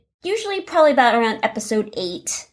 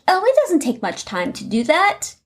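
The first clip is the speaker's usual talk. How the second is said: higher, lightly voiced breezy beginner; naive would-be podcaster; 7 semitones higher than usual. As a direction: lightly mimic someone who thinks it's easy. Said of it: "If you think".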